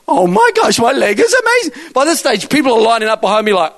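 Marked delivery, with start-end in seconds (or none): 0.1-1.9 high-pitched imitation of astonished delight; amazed joy. Healed woman marvelling at her leg, overjoyed. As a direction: act out her amazement with voice raised.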